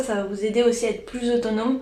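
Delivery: ordinary delivery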